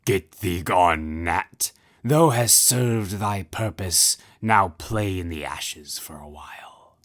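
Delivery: slow, cold royal dismissal sinking to a whisper; a merciless king brushing off his wife; cruel king. Acted. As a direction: lord it over her, then hiss the last line. The Red King dismisses Ozma.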